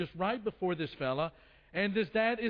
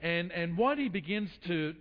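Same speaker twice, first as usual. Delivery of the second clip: usual speaking voice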